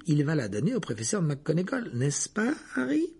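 high, insistent, leading question; dismissive assurance. A narrator's reading. Hermione pushing Harry to hand in the map, insistent assurance. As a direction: press for agreement, pitch climbing at the end. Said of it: "comme si l'idée lui paraissait ridicule".

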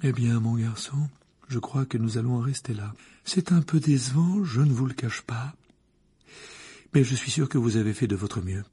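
lightly voiced, sighing letdown; teacher ending the exam, mildly disappointed; disappointed seer. A narrator's reading. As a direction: let him down gently but let it show. Trelawney disappointed.

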